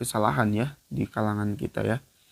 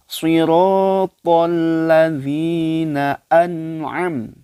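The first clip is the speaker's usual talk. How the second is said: slow, louder melodic Quran recitation; 6 semitones higher, 7 dB louder, much slower than usual. Acted. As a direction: chant the verse slowly like tilawah. Recites verse. (bacaan tilawah pelan dan lantang)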